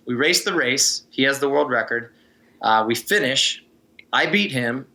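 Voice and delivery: ordinary talk, nothing marked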